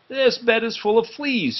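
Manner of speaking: high, bright complaint of fleas; exclaiming. Acted. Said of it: "he exclaimed".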